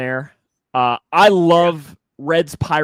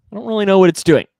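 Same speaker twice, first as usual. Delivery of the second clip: ordinary delivery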